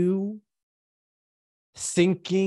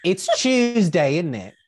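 put-on worst British accent; 2 semitones higher, 4 dB louder, slower than usual. Game master obliging a player's dare, worst British accent. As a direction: do a deliberately bad British accent with a laugh. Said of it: "give me your worst British accent".

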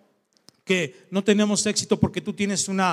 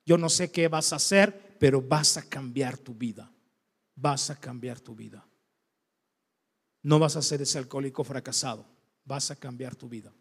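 tender mother; 6 semitones lower, 4 dB quieter than usual. Retold or imitated. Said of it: "Un día me dijo".

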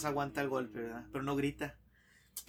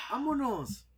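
grito de golpe, más agudo; 8 semitones higher, slower than usual. Acted. El último golpe muy fuerte.

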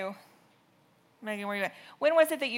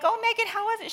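high, loud, chipper mock greeting; breezy greeting; 13 semitones higher, 7 dB louder than usual. Parent greets babysitter on returning home, high and chipper. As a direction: play it sing-song and carefree. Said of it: "I'm like".

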